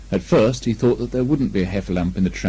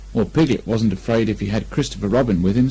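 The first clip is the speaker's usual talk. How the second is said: ordinary delivery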